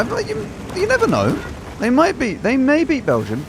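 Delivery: high squeaky mock optimism; high mock hopeful. Real, not acted. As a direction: pitch up, teasing hope. Mock hopeful about USA.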